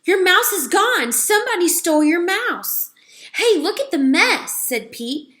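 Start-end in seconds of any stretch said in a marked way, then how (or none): 0.0-2.9 high and loud with news; excited. A narrator's reading. Girl telling Garth his mouse is gone, excited. 3.3-4.5 high, loud exclamation; surprised. A narrator's reading. Camper spotting the mess, surprised.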